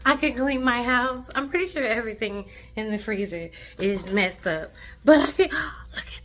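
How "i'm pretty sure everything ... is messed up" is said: usual speaking voice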